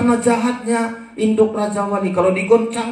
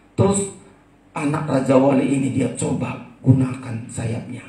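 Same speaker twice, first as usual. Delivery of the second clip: ordinary talk, nothing marked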